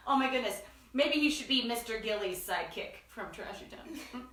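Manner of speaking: ordinary delivery, nothing marked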